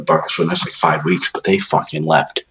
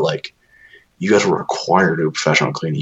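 normal talking voice

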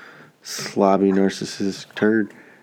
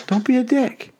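emphatic motto; 14 semitones higher than usual. Real, not acted.

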